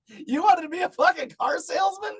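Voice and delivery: can't believe what he heard; incredulous. Real, not acted. Mocking the salesman's dream.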